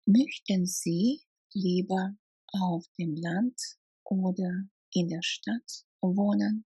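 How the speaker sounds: ordinary delivery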